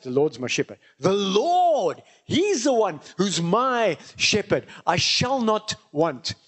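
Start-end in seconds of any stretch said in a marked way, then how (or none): none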